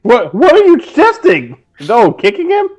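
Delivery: high, loud burst of disbelief; real startled demand, pitch leaping up; incredulous. Real, not acted. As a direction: question the player in loud astonishment. Game master startled by a roll.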